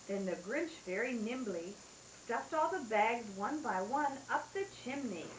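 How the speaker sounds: usual speaking voice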